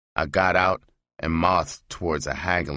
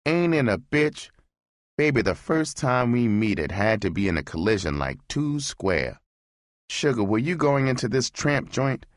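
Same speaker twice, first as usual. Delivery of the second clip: higher, sweet-talking hustler; smooth come-on; 6 semitones higher than usual. Acted. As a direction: chat her up, light and higher. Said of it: "I said".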